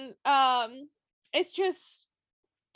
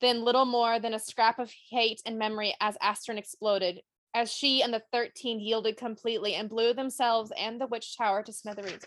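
measured book-reading voice, dropping low at the end; story narration from the page; reading aloud; 4 semitones lower than usual. Acted. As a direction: read the passage like a storyteller. Reads book passage aloud.

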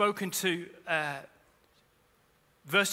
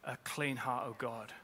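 prayerful scripture reading; 4 semitones lower, 5 dB quieter than usual. Acted. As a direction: read it like a quiet prayer.

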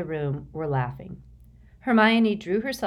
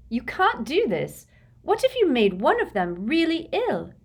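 alarmed protest; 9 semitones higher, 4 dB louder than usual. A narrator's reading. Hermione protesting.

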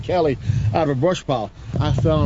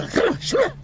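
high excited giggle. Acted.

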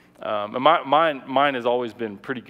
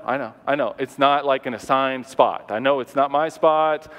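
normal talking voice

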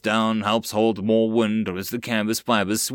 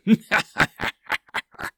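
high breathy goblin cackle; 10 semitones higher than usual. Acted. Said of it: "a laughing cry could be heard".

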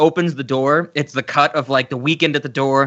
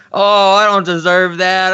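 whiny, drawn-out mock sob; slow, put-on blubbering plea; imitating Howard; 5 semitones higher, 5 dB louder, slower than usual. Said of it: "the way he delivers it".

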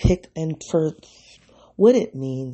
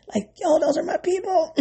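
high, laughing through the words; gleeful laugh while claiming her people; delighted giggly exclamation; 14 semitones higher than usual. Real, not acted. Excited about her people.

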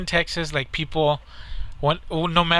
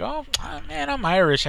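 higher-pitched, imitating a cocky friend; retold breezy boast; 11 semitones higher than usual. Friend waving off a drink warning, mock breezy boast. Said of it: "And he was like".

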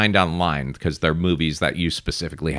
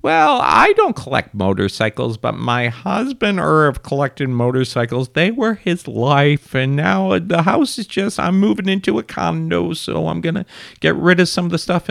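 woman reminiscing; 11 semitones higher than usual. Acted. Widow selling her husband's motorcycle stuff, high wistful act. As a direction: put on a high-pitched lady seller. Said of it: "this woman's like".

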